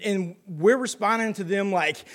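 normal talking voice

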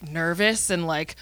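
ordinary talk, nothing marked

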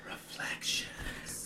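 slow, very soft whisper; whispered imitation. Co-host echoing the strip-club sounding name, slow whisper. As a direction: stretch it out in a whisper. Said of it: "just the way that Louise says it".

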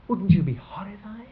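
ordinary delivery

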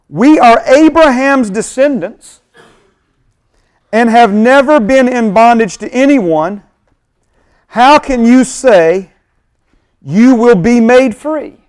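a hint of indignant crowd. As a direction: read it as offended listeners.

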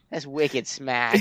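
put-on slow Boston accent, laughing; a hint of Boston, slow; mock Boston accent. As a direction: drawl it Boston style. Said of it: "But Boston is like".

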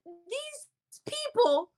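very high, drawn-out sneering voice; slow, high-pitched imitation of the laughing friend; mocking punchline. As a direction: stretch the insult out slowly and high. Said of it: "she's laughing so hard".

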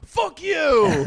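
very high, loud mock yell; imitating angry yell. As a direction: bark it like a soaked drunk lashing out. Drunk friend snaps back at him.

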